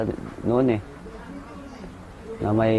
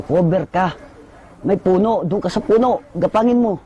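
urgent combat order; 7 semitones higher than usual. Retold or imitated. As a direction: command the private under fire. Nag-uutos sa bata sa putukan. (mataas at malakas na utos sa gitna ng putukan)

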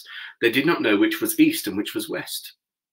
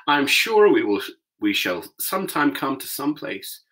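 Dorothy; 5 semitones higher, 4 dB louder than usual. A narrator's reading. Dorothy voiced in story.

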